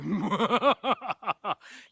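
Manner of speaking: loud villain's cackle; big stagey evil laugh; malicious laugh. Acted. The apothecary folds fingers evilly, malicious laugh. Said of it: "laughs maliciously".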